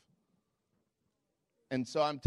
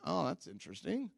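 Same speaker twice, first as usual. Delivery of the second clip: dry polite reply; 3 semitones higher, 7 dB quieter than usual. Retold or imitated. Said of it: "I went".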